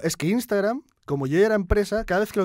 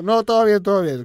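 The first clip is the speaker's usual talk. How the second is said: higher, louder mock reassurance; imitated covering up; 6 semitones higher, 8 dB louder than usual. Pretending to colleagues nothing is happening, mock-casual and raised. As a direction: act hastily casual, overdoing it high and loud. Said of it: "Y ahí como disimulando".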